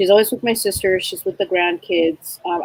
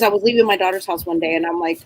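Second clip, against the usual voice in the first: normal talking voice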